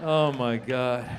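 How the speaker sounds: ordinary talk, nothing marked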